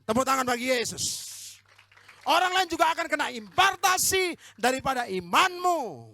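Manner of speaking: rousing sermon shout; preaching at full force. Acted. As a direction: thunder it out from the pulpit. Preacher leads reading, exhorts.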